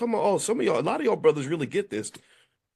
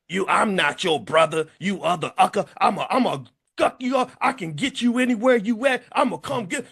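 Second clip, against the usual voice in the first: mock angry yell; 5 semitones higher, 3 dB louder than usual. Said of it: "He yelled".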